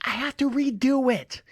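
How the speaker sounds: high, exaggerated mouthed delivery; wife mouthing words. Wife answers husband across the room, exaggerated mouthing. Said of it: "you know, with her mouth".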